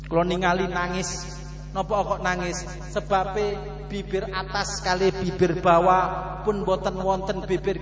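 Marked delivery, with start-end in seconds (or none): none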